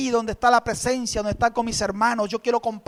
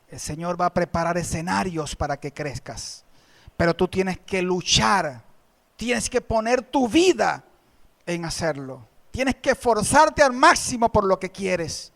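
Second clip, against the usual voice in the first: usual speaking voice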